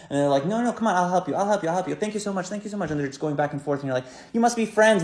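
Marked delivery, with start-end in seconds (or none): 0.5-2.9 higher, repeated sweet thanks; gushing politeness. Retold or imitated. Two strangers overthanking each other on the bus. 4.3-5.0 much higher, loud disbelief; mock exclamation. Rider baffled by two chatty strangers.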